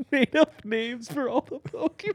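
high laughing words. Real, not acted. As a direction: laugh while talking. Funny video story.

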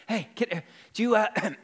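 lightly acted winded stammer; mock out of breath. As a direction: pretend to catch your breath between words. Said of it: "he's trying to catch his breath".

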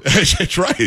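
ordinary talk, nothing marked

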